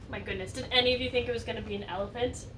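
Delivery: usual speaking voice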